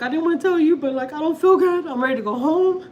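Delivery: high, loud weepy whine; crying to husband. Retold or imitated. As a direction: whine it out through imitated sobs. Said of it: "I started crying to my husband".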